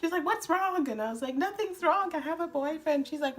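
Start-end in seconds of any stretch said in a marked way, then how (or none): 0.2-0.9 high, urgent mock alarm; alarmed mother. Mother asking her crying daughter, high and alarmed. 1.3-3.1 high-pitched wailing protest; mock happy crying. Daughter crying as she tells mom, high mock wail. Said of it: "Why are you crying?".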